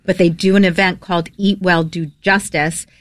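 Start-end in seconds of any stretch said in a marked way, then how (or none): none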